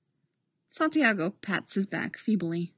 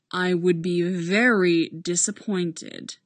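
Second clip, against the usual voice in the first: "very" weighted, slow and heavy; stress on very; 3 dB louder, slower than usual. Acted. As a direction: lean hard on "very" slowly. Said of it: "Holt stresses".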